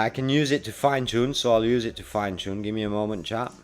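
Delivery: usual speaking voice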